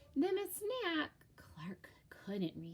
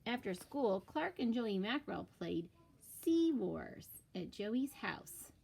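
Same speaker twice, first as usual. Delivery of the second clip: plain reading voice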